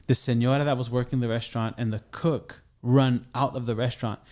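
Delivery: ordinary delivery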